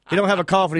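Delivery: ordinary delivery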